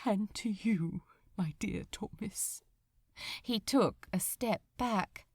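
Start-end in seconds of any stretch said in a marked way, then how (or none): 0.0-2.6 whispered, fading to almost nothing; quivering whisper. Acted. Angel says last goodbye to friend, trembling whisper. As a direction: hold back tears, barely breathe it out. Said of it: "His lips quivered as he said".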